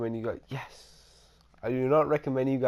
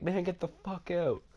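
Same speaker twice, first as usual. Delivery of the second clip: mock cussing; 7 semitones higher, 3 dB quieter than usual. Storyteller imitating the gang chasing him away, mock cussing. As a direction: imitate them ordering you off, pitched up. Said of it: "fucking start cussing at me".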